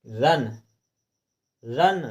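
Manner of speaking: रन said slowly, with the short a clearly heard; sounding out the vowel. Shown as a demonstration. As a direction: give each रन its own clear short a. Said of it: "इसमें रा में आ छुपा हुआ है". (रन में छुपा अ साफ़ सुनाएँ)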